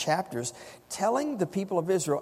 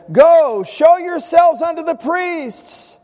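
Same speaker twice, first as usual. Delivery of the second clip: loud, high, drawn-out order; shouted command; 11 semitones higher, 12 dB louder, slower than usual. Acted. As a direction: send them off with a ringing shout. Preacher voices Jesus commanding the lepers.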